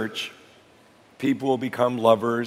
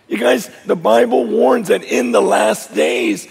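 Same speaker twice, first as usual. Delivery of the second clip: raised, higher preaching voice; 6 semitones higher, 4 dB louder than usual. Acted. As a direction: lift the warning to the congregation.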